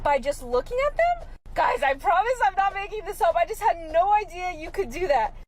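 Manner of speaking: can't believe it's true; amazed. Real, not acted. Vlogger telling viewers her amazement, pitched high.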